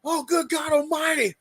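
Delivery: high, shocked announcer shout, slowing to the end; hammed-up outraged play-by-play call; wrestling announcer. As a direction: play the stunned ringside commentator. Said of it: "it's gonna be like this".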